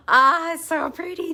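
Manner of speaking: gasp, then a loud high "so pretty"; sudden delight at the color. Real, not acted. Gasps at a new yarn color.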